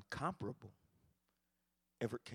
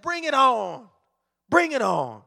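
loud, bold dare; defiant challenge; 9 semitones higher, 17 dB louder than usual. Acted. Elijah dares the five hundred prophets, loud defiance. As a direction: throw down the gauntlet.

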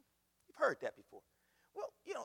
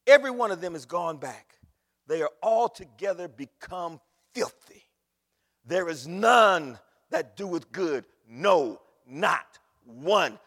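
loud, booming scripture reading; preaching at full force; 15 dB louder, slower than usual. Acted. Preacher booming scripture to the church, loud and forceful. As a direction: proclaim the verse to the congregation.

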